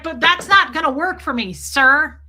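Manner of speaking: retold objection. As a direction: push back hard, landing on "sir". Acted objection to generator man.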